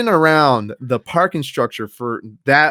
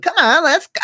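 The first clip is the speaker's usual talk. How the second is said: mimicking friend; 3 dB louder than usual. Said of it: "She's like".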